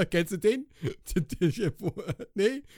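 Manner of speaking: ordinary talk, nothing marked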